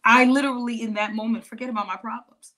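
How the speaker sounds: ordinary delivery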